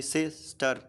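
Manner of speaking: slow "सिस्टर" in two separated beats; syllable by syllable. Shown as a demonstration. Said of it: "ऐसे ही". ("सिस्टर" धीरे, दो हिस्सों में तोड़कर)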